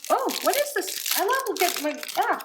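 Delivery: so thrilled she can barely speak; excited. Real, not acted. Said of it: "sorry, I got a little excited".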